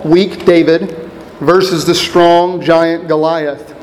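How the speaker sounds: normal talking voice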